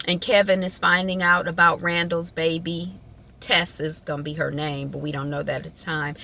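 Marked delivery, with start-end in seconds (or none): none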